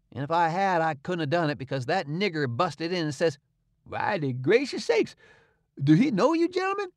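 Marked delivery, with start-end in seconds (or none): 3.8-6.9 a frightened servant gasping it out; startled servant. A narrator's reading. Servant asking the boys in an alarmed high voice.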